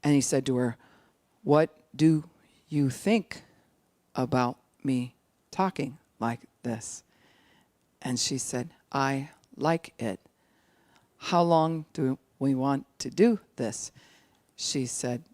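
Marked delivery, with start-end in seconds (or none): 1.4-7.0 deliberate question, spaced word by word; very slow. Acted. Husband asking his wife about his talking, very slow. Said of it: "'cause he slowed it that far down". 8.9-13.9 slow, spaced-out words. Acted. Wife answering husband in slowed talk, very slow. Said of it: "he slowed it that far down".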